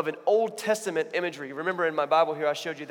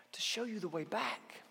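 whispered, slow and soft; Jesus ending in a whisper; hushed whisper; 5 semitones higher, 10 dB quieter, slower than usual. Retold or imitated. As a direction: drop to a tender whisper. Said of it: "Jesus says".

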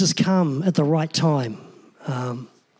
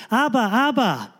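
higher-pitched imitation of a kid calling Dad; mock child's yell; 5 semitones higher than usual. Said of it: "he's yelling out".

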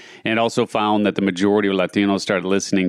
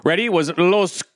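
high, dramatic Spanish accent announcing; 10 semitones higher than usual. Shown as a demonstration. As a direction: read the title with a hammy Spanish accent. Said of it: "my Spanish accent here".

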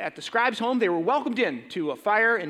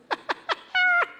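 a short high laugh. Real, not acted. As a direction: laugh at his own punchline. Laugh after punchline.

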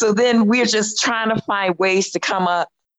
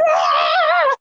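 joyful scream. Retold or imitated. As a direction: let out a thrilled high scream. Reacting to winning tickets.

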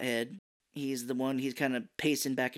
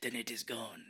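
smoky Zen master; 11 dB quieter than usual. Retold or imitated. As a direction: murmur it like an old sensei. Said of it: "It's a smoking voice".

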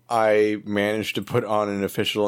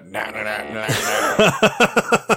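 droid beeping; 4 dB louder, slower than usual. Acted. Astromech sends a coded transmission in droid beeps.

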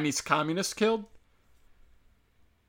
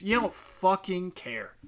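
normal talking voice

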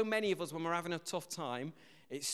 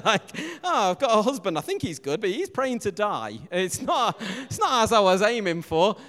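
loud, higher, laughing teasing; laughing banter; 4 semitones higher, 10 dB louder than usual. Real, not acted. Preacher teases Lisa in the congregation, loud and laughing.

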